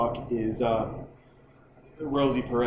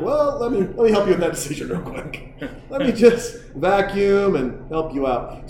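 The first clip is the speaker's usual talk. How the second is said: mom voice; 6 semitones higher, 3 dB louder than usual. Retold or imitated. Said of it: "his mom's like".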